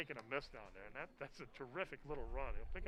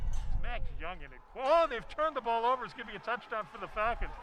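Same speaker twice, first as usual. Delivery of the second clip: louder, higher, shouting the scoop and score; excited touchdown call; 4 semitones higher, 8 dB louder than usual. Real, not acted. Announcer calling the defensive touchdown to the stream, excitedly. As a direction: let it rip as the fumble goes back for six.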